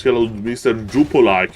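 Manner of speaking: ordinary delivery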